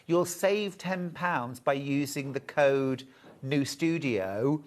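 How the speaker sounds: ordinary talk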